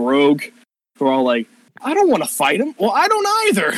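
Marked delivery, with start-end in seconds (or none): 1.8-2.7 higher reluctant protest; nervous ranger. Acted. Ranger refusing to fight the pirate, higher. 2.8-3.7 very high squeaky reply; small rogue. Acted. Small rogue agreeing with the ranger, very high.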